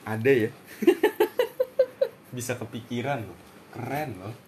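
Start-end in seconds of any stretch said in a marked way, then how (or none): 0.5-2.1 high squealing laugh; real laugh, very high. Real, not acted. Keeps laughing.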